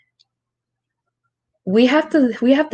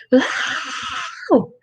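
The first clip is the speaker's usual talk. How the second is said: put-on drawn-out toddler squeal, higher; imitated toddler wail; 5 semitones higher than usual. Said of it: "and she be like".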